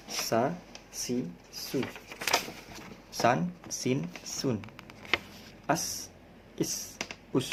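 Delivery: Sa, si, su with a thin hiss. Shown as a demonstration. Teacher to class, hissing sin drill. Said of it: "huruf sofir berdesis".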